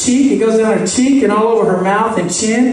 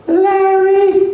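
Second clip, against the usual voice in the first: very high, held-out stepdad impression; mock high-pitched call; 10 semitones higher than usual. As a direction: put on a piping high man's voice. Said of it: "one of those really high-pitched voices".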